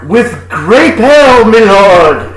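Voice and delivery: slow, loud, hammed-up olde English; mock fair server. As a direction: proclaim it grandly, high and drawn out. Plays at Renaissance fair speech.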